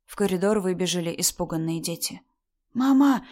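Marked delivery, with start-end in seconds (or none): 2.7-3.2 put-on high, childlike curious question; little daughter. A narrator's reading. Daughter asking mother about the visitor, curious child. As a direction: ask innocently in a raised child voice. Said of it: "спросила дочь".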